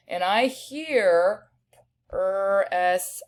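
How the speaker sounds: press, said slowly and drawn out; teacher sounding out press for spelling. Shown as a demonstration. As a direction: stretch press so each sound is heard. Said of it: "I hear four sounds there".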